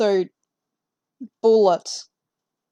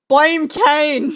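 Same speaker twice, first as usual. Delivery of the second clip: higher, louder teasing call; playful blame; 5 semitones higher, 8 dB louder than usual. Real, not acted. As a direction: toss the blame with a grin.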